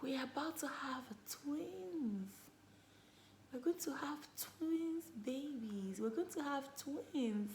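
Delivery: slow, soft-spoken announcement of twins; hushed news. Retold or imitated. Telling husband on the phone, slow and hushed. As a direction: let it sink in gently, slowly. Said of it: "It's like".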